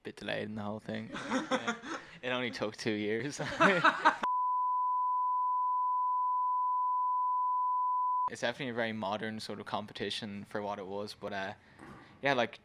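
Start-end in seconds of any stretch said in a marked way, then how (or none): 3.5-8.2 long high laugh. Real, not acted. Bandmates laughing at the story.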